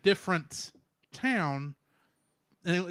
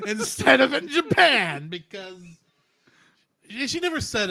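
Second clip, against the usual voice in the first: laughing; 5 semitones higher, 7 dB louder than usual. Real, not acted. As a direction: crack up while saying it. Laughing at the dub.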